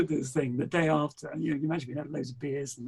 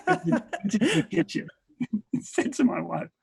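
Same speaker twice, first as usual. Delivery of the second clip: laughing; 9 semitones higher, 4 dB louder than usual. Real, not acted. Laughing while telling.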